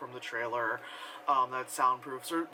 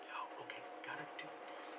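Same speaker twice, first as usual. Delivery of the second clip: urgent whisper; 16 dB quieter, faster than usual. Retold or imitated. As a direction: whisper it so nothing can hear you. Characters must stay silent outside.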